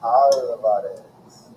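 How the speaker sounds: Alvarez said slowly in Spanish, open ah and trilled r; rolled Spanish Alvarez. A narrator's reading. Narrator savoring the Spanish sound of Alvarez. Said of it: "trilling my tongue for the drum roll".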